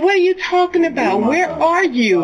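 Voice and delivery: high, frightened questions, slowing at the end; retold panic. Mother questioning her son after the killing, high and frightened. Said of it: "I said".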